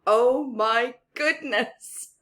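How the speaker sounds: ordinary delivery, nothing marked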